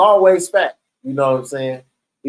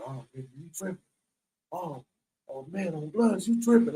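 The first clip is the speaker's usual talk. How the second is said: much softer, near-whispered mock warning; hushed warning; 12 dB quieter than usual. Cellmate warns as goon squad comes.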